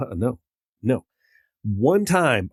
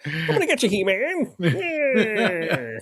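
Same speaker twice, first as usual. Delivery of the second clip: high, strained cartoon villain cackle-talk; Skeletor cartoon voice; 8 semitones higher, much slower than usual. Friend mocking the wimpy cartoon villain, high villain whine. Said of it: "he had that voice".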